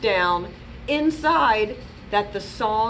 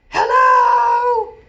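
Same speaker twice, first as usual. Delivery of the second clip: big high shouted hello; loud shout; 11 dB louder than usual. Acted. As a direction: bellow it like the loudest kid. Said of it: "who is always too loud".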